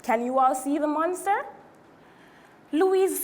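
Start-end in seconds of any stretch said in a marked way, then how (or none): none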